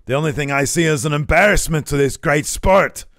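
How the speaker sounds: loud, high hammed-up wrestler voice; mock Gunther. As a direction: sneer it like a heel. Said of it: "Gunther says".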